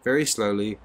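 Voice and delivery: ordinary delivery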